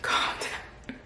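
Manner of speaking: whispered calm down; whisper to self. Real, not acted. Vlogger settling herself down after laughing, whispering. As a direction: whisper it to yourself. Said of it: "So let me just pause".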